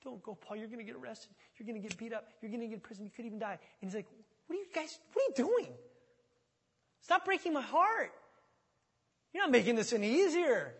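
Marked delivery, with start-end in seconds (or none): 0.0-3.6 soft, urgent mock begging; hushed pleading. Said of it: "They're crying. They're begging. They're pleading". 4.5-10.7 high-pitched, upset protest; exasperated pleading. Acted. Acting Paul rebuking weeping friends.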